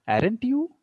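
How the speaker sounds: "you" swept upward; rising tag question. Shown as a demonstration. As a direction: toss up "Aren't you" as a tag seeking agreement. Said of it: "Rising tone is there".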